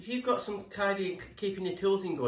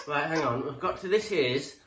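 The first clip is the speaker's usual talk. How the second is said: ordinary delivery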